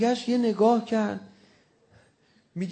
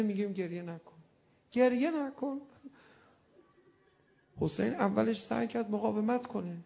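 ordinary delivery

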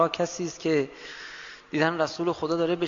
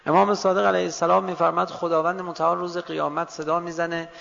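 ordinary talk, nothing marked

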